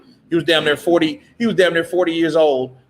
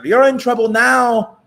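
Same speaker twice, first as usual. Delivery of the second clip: much higher, louder catty threat; bitchy Oscar voice; 9 semitones higher, 4 dB louder than usual. Retold or imitated. Oscar's version set against Canelo's, bitchier. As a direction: sneer it with a little bitch behind it. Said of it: "Oscar ... See the difference? Kinda more bitchy".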